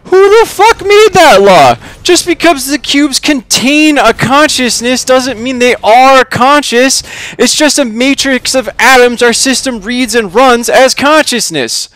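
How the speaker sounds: high, loud outraged rant; Charlie. A narrator's reading. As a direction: rage at an absurd rule. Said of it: "exclaims Charlie".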